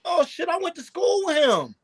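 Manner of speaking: high, shocked outburst; surprised exclaim. Retold or imitated. Employee recalling a schoolmate, shocked high outburst. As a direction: blurt it out in shock. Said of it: "he was like".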